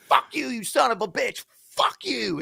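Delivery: high strained curse, volume held back; mock yelling. Rider curses cabbie while walking away, mock yell. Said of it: "yelling at each other".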